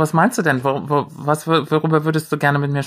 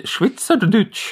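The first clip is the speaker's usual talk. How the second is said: Swiss German; 8 semitones higher, much slower than usual. Shown as a demonstration. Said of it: "Das war so ein bisschen Switch".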